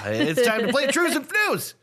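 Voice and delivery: high, loud game-show announcer shout; excited game announcement. Pretend. Host announcing the show's regular game, hammy high shout. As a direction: belt out the game's name like a host.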